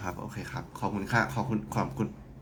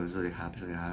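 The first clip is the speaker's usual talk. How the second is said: low, very soft, drowsy demo; mock low-energy mumble; sleepy greeting; 5 semitones lower, 13 dB quieter than usual. Shown as a demonstration. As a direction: greet as if nodding off, barely voiced. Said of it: "พยายามที่จะแบบไม่ง่วงนอนแบบ".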